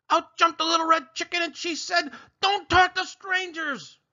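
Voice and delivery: very high, loud chicken warning; chicken bursting in. Acted. Chicken barges into the story, shrieking high.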